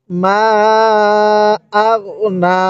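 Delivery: মা held very long, then আগ, then a lengthened না; long steady vowel on মা, shorter hold on না; model elongated recitation. Shown as a demonstration. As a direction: recite মা আগ না slowly, stretching মা most. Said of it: "কিভাবে পড়ব?".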